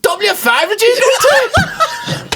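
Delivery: very high, slow, drawn-out laughing question; laughing punchline. Retold or imitated. Friend delivering the punchline of the W five story, squealing with laughter. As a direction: crack up while acting out the mate's question.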